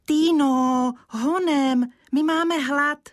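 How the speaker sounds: put-on high, loud child's call; urging call. Kája urges Týna to hurry, calling loudly. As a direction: hurry her along, calling out. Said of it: "Kája pořád pobízel".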